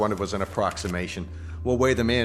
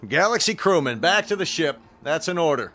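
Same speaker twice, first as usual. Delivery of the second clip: raised, higher command across the crowd; barked order; 6 semitones higher, 4 dB louder than usual. Acted. Colonel calling his crewmen back to the ship, raised order. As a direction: call the men back like an officer.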